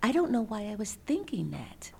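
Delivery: mock-sad child, fading to a whisper; a hint of a sad five-year-old, hushed at the end; sad little voice. Mother retelling her son's confused apology, softly sad and whispered. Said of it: "with this really sad face".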